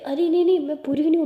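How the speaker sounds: high, loud insistent denial; coaxing protest. Acted. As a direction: protest innocence to a small child. Protests to the child she isn't bad.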